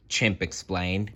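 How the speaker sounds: plain reading voice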